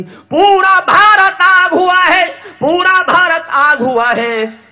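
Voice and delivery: high, loud, very drawn-out cry; blazing declamation, every word stretched; fiery verse. Acted. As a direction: roar the line out slowly. Fiery climax of stage poem.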